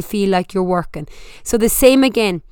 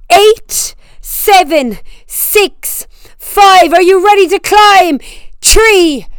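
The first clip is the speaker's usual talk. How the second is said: higher and louder, pushing hard; fired-up spin coach; 6 semitones higher, 6 dB louder than usual. Real, not acted. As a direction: drive the class through every count. Coaches sprint intervals, building intensity.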